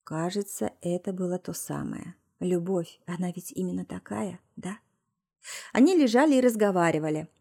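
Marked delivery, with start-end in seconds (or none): 0.0-4.8 soft, hushed inner thought; tender murmur. A narrator's reading. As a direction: muse quietly, as if to oneself. Woman's tender thoughts after lovemaking.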